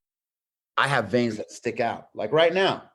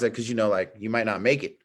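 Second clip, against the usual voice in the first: ordinary delivery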